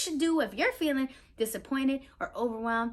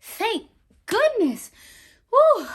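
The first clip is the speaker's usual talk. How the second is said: higher, louder, slow relieved sigh; sigh of relief; 10 semitones higher, 9 dB louder, slower than usual. Acted. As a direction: breathe out all the worry. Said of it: "sighed in relief".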